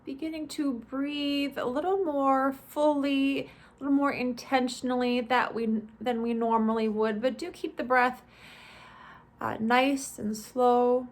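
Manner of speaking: measured, spacious body-scan instruction; guided meditation. Acted. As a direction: ease listeners through each step, stretching the phrase endings.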